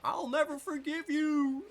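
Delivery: high, loud, slow hammed-up English dub yell; cheesy dub-voice battle cry; mock dub shout. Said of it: "probably would have been something like".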